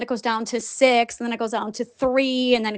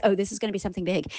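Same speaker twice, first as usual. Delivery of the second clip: quick, lower, softer realization; quick aside; 6 semitones lower, 9 dB quieter, much faster than usual. Retold or imitated. Host first realizing what is coming, quick low aside. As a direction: toss it off fast and low, as a dawning thought.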